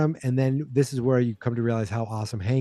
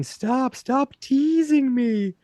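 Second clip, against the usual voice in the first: mock screaming; 12 semitones higher, much slower than usual. Retelling Bill screaming outside, mock high-pitched scream. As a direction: wail it high and slow. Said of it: "is, like, screaming".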